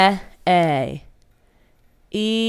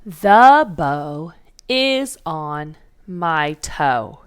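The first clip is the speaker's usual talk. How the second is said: slow dictation; 3 semitones lower, much slower than usual. Shown as a demonstration. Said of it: "We are about to work on our sentence for today".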